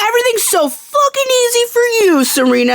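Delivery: high, mocking whine; whiny bitter Dan. Imitating Dan snapping at Serena, mock whine. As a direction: sneer it like a sulking boy. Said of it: "He's like".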